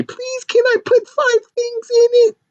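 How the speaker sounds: very high mock-begging voice; whiny pleading. Writer begging to add things to script, pleading. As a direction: plead like a squeaky little kid.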